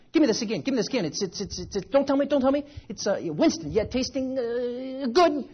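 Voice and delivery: high, hammed-up eager cramming; high-pitched pretend panic to memorise it; mock frantic studying. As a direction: play an over-keen pupil, racing and stammering. Mock eager child studying a commercial.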